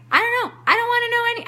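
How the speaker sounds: high, loud, frantic refusal; acted refusal. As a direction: reenact shutting someone out, voice shooting up. Acting out refusal to the universe.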